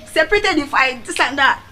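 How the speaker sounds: ordinary delivery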